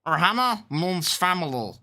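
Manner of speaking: high, loud alien-critter voice; Stitch. Shown as a demonstration. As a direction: belt it out as a cartoon alien creature. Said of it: "I can do Stitch from Lilo and Stitch".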